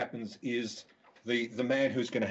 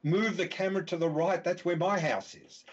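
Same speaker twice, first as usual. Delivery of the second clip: higher-pitched mock cry at ordinary volume; retold scream; 6 semitones higher than usual. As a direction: imitate a cinemagoer crying out, without really screaming. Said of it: "gets up in the middle of the cinema and screams".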